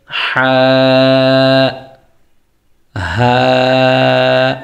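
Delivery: two drawn-out হা sounds, one from the throat's start, one from its middle; Arabic letter sounds. Shown as a demonstration. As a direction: sustain each হা from a different point in the throat. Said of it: "এখানে দুইটা হা আছে".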